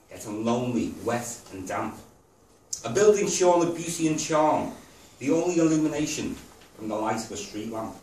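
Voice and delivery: rhythmic spoken-verse delivery; poem recital. Real, not acted. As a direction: recite it line by line, pausing after each phrase. Reads own poem to audience.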